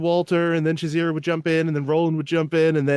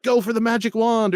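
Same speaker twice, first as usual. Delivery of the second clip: higher, imitated player calling out; excited player; 8 semitones higher than usual. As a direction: call out a suggestion as an eager player. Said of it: "people would ... be like".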